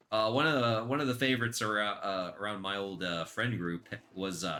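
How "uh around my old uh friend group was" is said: normal talking voice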